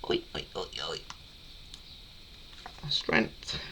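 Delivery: straining grunts over a tight lid; opening a stuck jar. Real, not acted. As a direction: push out strained, effortful words. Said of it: "Of course I can't get the lid off".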